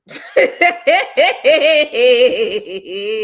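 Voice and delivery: loud rolling laugh; long laugh. Real, not acted. Laugh heard.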